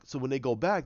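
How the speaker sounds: ordinary talk, nothing marked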